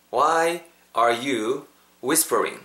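slow, word-by-word delivery with gaps; drawn-out teaching pace; slow model. Shown as a demonstration. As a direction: model "Why are you whispering" slowly for learners to repeat. Teacher models sentence for repetition.

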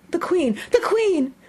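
high, loud alarmed call. A narrator's reading. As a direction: cry out in alarm. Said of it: "called out".

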